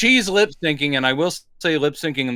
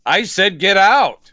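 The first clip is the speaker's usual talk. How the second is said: slightly louder, slow mock command; mild raised voice; 3 semitones higher, 5 dB louder, slower than usual. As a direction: briefly raise the voice, half-hearted threat. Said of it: "he raises his voice for half a second".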